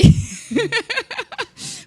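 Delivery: very high, ringing laugh; high peal of laughter. Real, not acted. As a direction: laugh off the rejection. Laughs at her own rejection.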